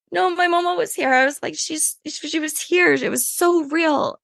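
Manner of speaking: high, teary pleading to her partner; choked up, voice pitched high with emotion; tearful retelling. Retold or imitated. Retelling to partner, high and teary. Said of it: "I was crying so hard".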